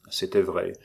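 usual speaking voice

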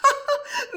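high, clear peal of laughter; high ringing laugh. A narrator's reading. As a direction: burst into a bright mocking laugh. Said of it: "partit d'un rire clair".

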